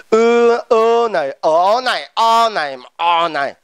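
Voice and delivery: same vowel sung out on different tones; varying tones. Shown as a demonstration. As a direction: draw out ư, ơ, ờ, o, each on a different pitch. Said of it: "Tiếng Hàn ý không có dấu".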